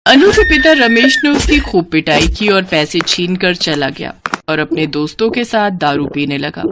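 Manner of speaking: ordinary delivery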